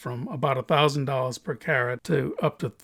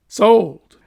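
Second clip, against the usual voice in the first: loud; 6 semitones higher, 10 dB louder than usual. Acted. Cutter accepts ten thousand dollar offer.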